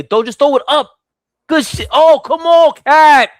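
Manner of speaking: cheering the shot on, then groaning at the miss; excited yelling. Real, not acted. Live reaction to Knicks play.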